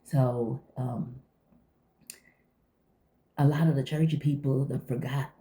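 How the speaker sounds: ordinary talk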